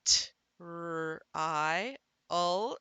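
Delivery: "t, r, I, ul" as separate sounds; sounded out. Shown as a demonstration. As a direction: spell out "t, r, I, ul" sound by sound, with pauses. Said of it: "And you'd say".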